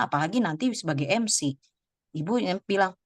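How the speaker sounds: usual speaking voice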